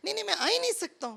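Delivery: high, fast stubborn refusal; a hammed-up stubborn passenger, high and hurried. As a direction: squeak out a quick stubborn no. Man who thinks he is a car.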